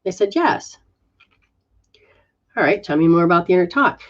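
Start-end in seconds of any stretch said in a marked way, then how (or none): none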